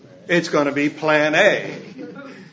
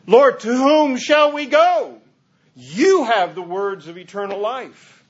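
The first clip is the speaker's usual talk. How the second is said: much higher, louder, slow plea; earnest Peter; 7 semitones higher, 4 dB louder than usual. Retold or imitated. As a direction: Peter pledging loyalty. Peter quoted answering Jesus.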